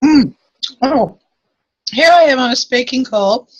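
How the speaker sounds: talking with a mouthful of food; mouth full. Real, not acted. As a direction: speak around a mouthful of food. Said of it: "I've got a mouthful of food".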